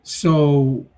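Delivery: ordinary talk, nothing marked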